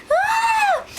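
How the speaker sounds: high excited squeal; a sudden delighted yelp. Real, not acted. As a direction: squeal with glee. Excited about the food delivery.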